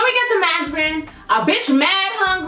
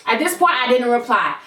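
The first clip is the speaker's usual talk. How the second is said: ordinary talk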